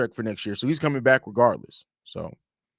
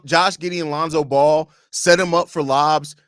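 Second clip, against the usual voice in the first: usual speaking voice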